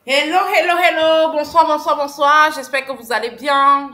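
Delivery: très fort et enjoué, pour lancer le live; accueil énergique. Real, not acted. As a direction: saluer bien fort toute la communauté. (lancer le live à pleine voix pour saluer les spectateurs, très fort)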